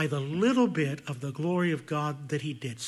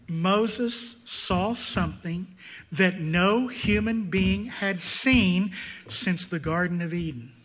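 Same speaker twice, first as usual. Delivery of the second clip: normal talking voice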